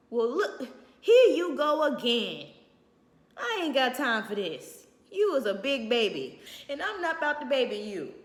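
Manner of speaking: loud, impatient brush-off; loud dismissive. Retold or imitated. A cold man brushing off a crying woman, loud and impatient. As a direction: bark it like he's fed up.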